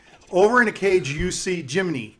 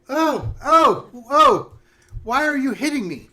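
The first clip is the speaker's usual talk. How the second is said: high, loud yelps of protest; wounded witch; 9 semitones higher, 7 dB louder, slower than usual. Acted. Witch gets hit on the head, high loud yelping protest. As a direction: cry out in a high witchy complaint. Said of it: "And she's like".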